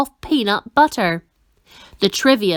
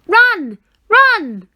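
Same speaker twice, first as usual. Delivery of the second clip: high, loud urgent call; crying out; 11 semitones higher, 7 dB louder than usual. A narrator's reading. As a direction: yell it as a warning from afar. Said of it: "a voice cried out".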